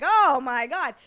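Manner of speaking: loud, hammed-up exclamation; exaggerated mock shock. Streamer mocking exaggerated physical comedy, loud mock shock. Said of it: "where I'm just like".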